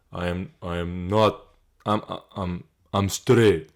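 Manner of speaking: a lightly voiced Yuri struggling for words; imitating Yuri. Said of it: "I can't even do his fucking voice".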